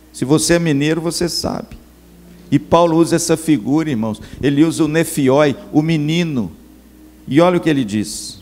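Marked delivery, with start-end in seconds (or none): none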